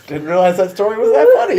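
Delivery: loud words through laughter; shouting while laughing. Real, not acted. Laughing at his own story.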